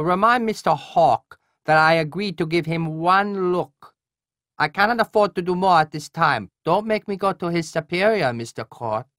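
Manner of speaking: a stern colonel refusing, nasal and high; nasal Korean colonel. Acted. Voices Colonel Hwan on phone.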